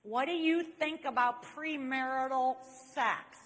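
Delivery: slow, loud, deliberate awkward-guy voice; Jerry. Retold or imitated. Awkward friend repeats his question, slow deliberate imitation. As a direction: imitate him plodding through the question. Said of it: "he asked it again".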